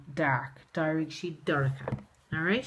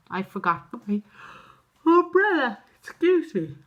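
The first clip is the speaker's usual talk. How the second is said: put-on high, louder little girl's voice; child voice; 6 semitones higher, 5 dB louder than usual. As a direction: play the pupil apologising in a small bright voice. Child answers teacher sheepishly.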